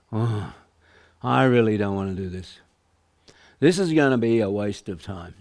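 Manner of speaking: low, sagging voice of dread; gloomy reluctant self-talk. Shown as a demonstration. Salesperson's negative self-talk about prospecting, low and gloomy. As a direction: groan it like a salesperson dreading the calls. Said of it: "Notice the tone of voice I'm using".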